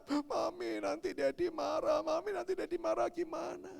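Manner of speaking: put-on high-pitched whining kid; mock tearful child; imitated crying child.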